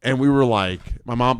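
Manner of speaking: ordinary delivery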